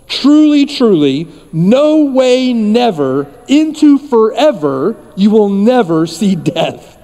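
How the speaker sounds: slow, forceful build on INTO FOREVER; emphatic declaration. Acted. Preacher piling up the Lord's promise to congregation, emphatic.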